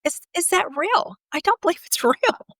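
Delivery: high, incredulous retelling; disbelief. Retold or imitated. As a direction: imitate her own shocked disbelief, pitched high. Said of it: "And I said".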